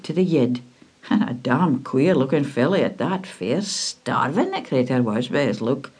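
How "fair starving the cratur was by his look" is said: ordinary delivery, nothing marked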